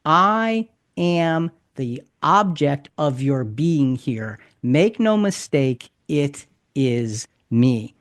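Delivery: slow, weighty, each word set down firmly; emphatic declaration. Shown as a demonstration. Teacher voicing Jesus naming himself to the mob, slow and emphatic. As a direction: declare it slowly with no doubt left. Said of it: "This is an emphatic description".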